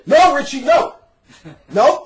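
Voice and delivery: loud high no; retold shout. Arguing doctrine with Richie, loud emphatic no. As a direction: shout him down with no.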